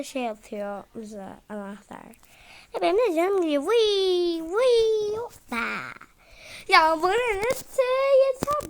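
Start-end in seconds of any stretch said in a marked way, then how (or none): 2.7-5.6 high, loud whining cry; loud whine. Real, not acted. Losing health in game. 6.7-8.7 high, loud fed-up yell; annoyed shout. Real, not acted. Frustrated at game enemy.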